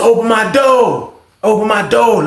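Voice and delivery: high, slow call to open the door; calling to the guard. Retold or imitated.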